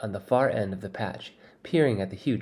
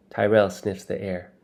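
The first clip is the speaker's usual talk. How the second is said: usual speaking voice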